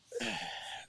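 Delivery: high breathy sigh. Real, not acted. Before eating on camera.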